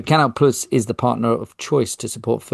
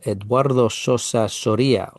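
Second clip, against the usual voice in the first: put-on Spanish accent. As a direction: say Eduardo Sosa Soria the Spanish way. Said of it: "I don't know why I went Spanish".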